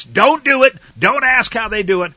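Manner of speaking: loud, high preaching shout. Real, not acted. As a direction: thunder it at the congregation. Preacher thundering a warning.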